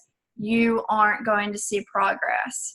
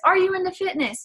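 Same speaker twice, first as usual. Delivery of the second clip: light friendly ask; 8 semitones higher than usual. Shown as a demonstration. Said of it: "send one message that says".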